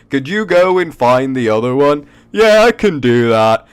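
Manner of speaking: high, loud goofy guard; dopey guard voice. Acted. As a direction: blurt it out like a clumsy guard. Guard NPCs talking.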